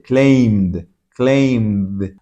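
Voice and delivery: "Claimed" as one drawn-out, low syllable ending in d. Shown as a demonstration. Teacher models the ending for learners, "Claimed" low with a voiced d. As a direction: say "Claimed" as "klaymd", voiced to the end. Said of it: "como una D".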